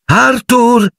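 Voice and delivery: high shout across the platform; mother calling her husband from the train, high shout. Acted. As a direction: call out to him sharply. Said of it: "Gridò la signora Weasley".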